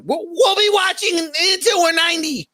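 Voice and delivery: put-on high, stammering nervous teen; high wobbly Morty impression. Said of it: "Entire thing in Morty's voice".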